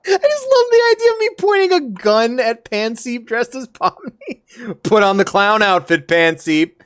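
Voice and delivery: high, giggly talking; laughing through words. Real, not acted. Streamer gushing over fan art, giggling high.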